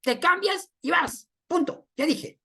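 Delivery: scolding mother. Acted. Mother refusing to listen to her daughter, scolding her high and sharp. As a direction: bark orders at her daughter, allowing no reply. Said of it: "Se queda con el mensaje racional".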